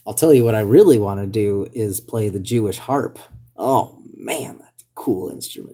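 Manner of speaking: ordinary delivery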